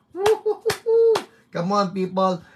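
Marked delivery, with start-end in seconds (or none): 0.1-1.2 high, loud woo-hoo; soaring worship whoop; ecstatic whoop. Real, not acted. Excited worship shout.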